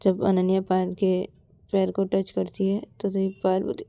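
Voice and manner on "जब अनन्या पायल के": usual speaking voice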